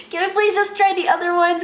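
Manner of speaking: high, loud begging; pleading. Retold or imitated. Bride begging the lady to keep trying dresses, mock pleading.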